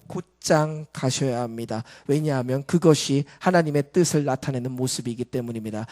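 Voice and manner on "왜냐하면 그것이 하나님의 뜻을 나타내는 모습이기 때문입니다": ordinary delivery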